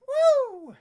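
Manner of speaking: high, loud "woo"; short falling cheer; excited whoop. Real, not acted. Cheering as game starts.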